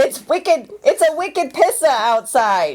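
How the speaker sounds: high, drawn-out mock Boston accent; New England accent. Showing off the accent from home, New England accent. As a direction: lay on a thick Boston accent. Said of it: "That's where you're from".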